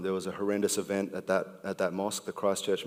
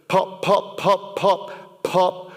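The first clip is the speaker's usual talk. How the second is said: popping sounds; 10 semitones higher, 5 dB louder, much slower than usual. Acted. Mimics cereal popping in poem.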